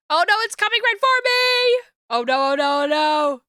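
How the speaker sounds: rising panic, loud and high; panicked. Acted. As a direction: yelp in alarm. Softball flying at narrator.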